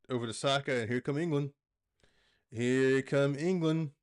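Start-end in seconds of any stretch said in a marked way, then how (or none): 2.5-3.9 put-on slow, drawn-out try at a British commentator accent; attempted British accent. Calling England's attack in a halting British commentator accent. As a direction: stretch it out in a shaky British accent. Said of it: "I can't do the accent".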